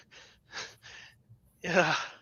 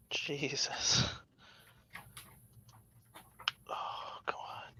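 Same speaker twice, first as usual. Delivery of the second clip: whispering; 13 dB quieter, much slower than usual. Real, not acted. Struggling with glued piece.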